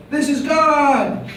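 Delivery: high, slow, drawn-out booming call; yelled from a cliff. Retold or imitated. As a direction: call it out long and high as if God speaks from a cliff. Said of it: "and I yelled off".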